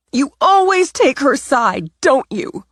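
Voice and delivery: high, loud, resentful whine of a question; bitter accusation. Acted. Natalie accusing her grandmother Cece of favouritism, high and bitter. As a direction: throw the blame at her, pitched high.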